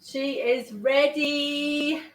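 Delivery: stretched-out satisfied declaration; slow, savoring delivery; slow proud announcement. Real, not acted. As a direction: draw it out slowly with pride.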